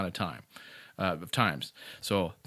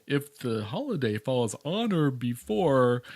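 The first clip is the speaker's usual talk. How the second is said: slow recital of posted rules; website notice quote; slower than usual. Retold or imitated. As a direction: quote the rule slowly and deliberately. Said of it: "I think it said".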